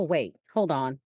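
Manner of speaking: ordinary talk, nothing marked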